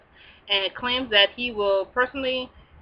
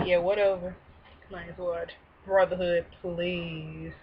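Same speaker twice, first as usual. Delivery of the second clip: dismissive scoff; 4 semitones lower, 6 dB quieter, slower than usual. Pretend. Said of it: "I'm like".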